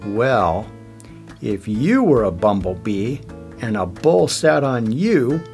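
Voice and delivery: plain reading voice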